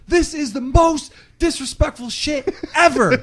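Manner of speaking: high, loud outraged yell; mock outraged shout. Imitating Blade snapping at friends in Walmart, high outraged shout. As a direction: blow up like a friend who's lost it. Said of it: "He's like".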